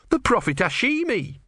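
high, insistent retort; indignant. A narrator's reading. Disciple naming a prophet to his god, high indignation.

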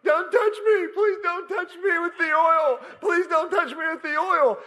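high-pitched panicked pleading; terrified spirit. Retold or imitated. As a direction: imitate a cornered spirit begging for mercy. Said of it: "this spirit starts going".

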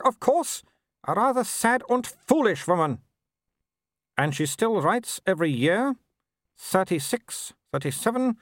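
high, disdainful aristocratic lady; haughty Baroness. A narrator's reading. Mother scorning Vimes to her son, high haughty lady. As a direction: sniff down at everyone like a grand dame.

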